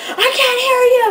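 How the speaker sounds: high, loud crowd-hyping yell; retold concert yell; shouted call. Singer calling to the crowd.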